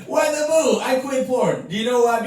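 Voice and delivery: high-pitched comic indignation; indignant protest. Real, not acted. Challenging audience who booed his announcement.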